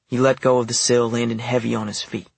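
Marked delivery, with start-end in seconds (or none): none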